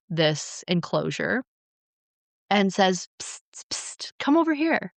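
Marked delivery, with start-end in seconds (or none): 3.2-4.1 unvoiced whispered psst to call them. Enki sneaking in to lure humans, whispered psst. 4.2-4.9 higher, coaxing come-hither voice; sly Enki. Retold or imitated. Enki coaxing the humans over, sly higher voice.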